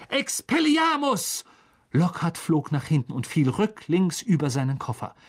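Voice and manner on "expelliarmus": high, drawn-out incantation; pitched far up, stretched spell cry; shouted spell. A narrator's reading. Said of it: "als Harry rief".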